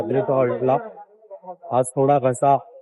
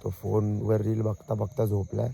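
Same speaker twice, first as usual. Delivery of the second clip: quiet at night; 9 dB quieter than usual. Real, not acted. Late night, others sleeping.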